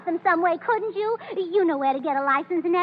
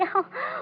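giddy laugh; 8 semitones higher, 5 dB quieter than usual. Acted. Giddy over the elopement.

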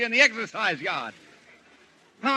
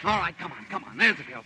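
normal talking voice

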